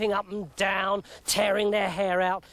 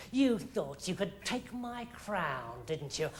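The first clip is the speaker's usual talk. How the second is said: soft menacing gloat; 4 semitones lower, 9 dB quieter than usual. Acted. King gloats quietly.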